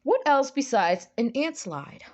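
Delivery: ordinary talk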